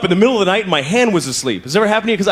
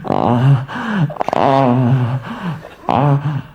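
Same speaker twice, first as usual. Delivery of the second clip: mock sex moans; 6 semitones lower, much slower than usual. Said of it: "you're like".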